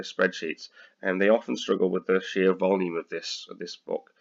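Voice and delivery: usual speaking voice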